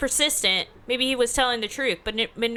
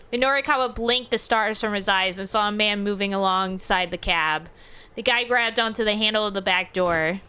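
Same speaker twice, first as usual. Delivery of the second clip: ordinary delivery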